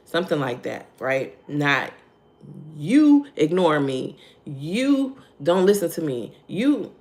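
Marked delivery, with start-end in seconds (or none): 2.8-6.9 mock accusation, leaning hard on YOU; accusatory. Shown as a demonstration. Coach shows how not to address a partner, accusatory. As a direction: stretch and punch each "you" like pointing blame.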